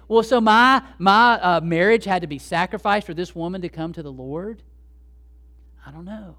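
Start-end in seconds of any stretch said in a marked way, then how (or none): 0.1-4.6 loud, halting protest on the opening words; indignant disbelief. Acted. Preacher voicing a hurt spouse questioning the sacrifice, indignant. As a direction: start loud and affronted, settle into a questioning rise.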